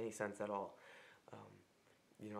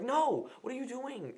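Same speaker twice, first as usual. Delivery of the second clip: loud, high-pitched "no" outburst; acted-out frustrated shout; exasperated yell; 11 dB louder than usual. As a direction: yell in mock outrage at a phone assistant. Acts out yelling at Siri.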